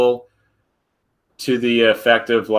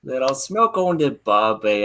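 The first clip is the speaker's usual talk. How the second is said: mock Australian accent; 2 semitones higher, slower than usual. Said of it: "Can you do it in a British accent?".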